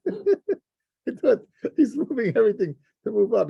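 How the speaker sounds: chuckling at the joke while saying it; laughing. Real, not acted.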